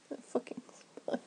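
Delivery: whispered curse at the spider; annoyed whispered swear; hissed whisper. Acted. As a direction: breathe it out under your breath. Whispered aside about spider.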